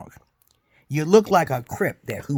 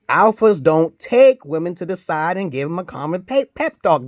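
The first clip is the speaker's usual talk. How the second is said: loud rant; 6 semitones higher, 8 dB louder than usual. Real, not acted. Ranting about alphas.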